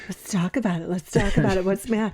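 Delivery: teasing sing-song concern for a crabby partner; mock tender coaxing. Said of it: "your little light voice".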